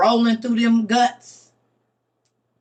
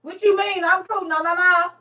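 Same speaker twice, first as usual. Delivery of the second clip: higher, tight, defensive voice; mock denial; 8 semitones higher than usual. A cleanser to a friend, denying it in a higher, tight voice. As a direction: pretend nothing's wrong, clenched.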